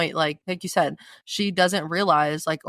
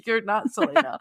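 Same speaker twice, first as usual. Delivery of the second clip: laughing through quote; 5 semitones higher than usual. Real, not acted. Laughing while quoting Mort.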